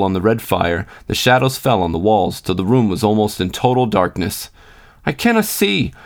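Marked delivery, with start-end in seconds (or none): 5.0-5.9 put-on high-pitched boyish whine; high, put-on complaint from a sulky child; grumbling. A narrator's reading. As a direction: grumble in a small boy's high voice. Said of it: "grumbled the invisible John".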